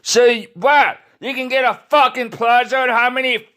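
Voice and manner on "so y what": ordinary talk, nothing marked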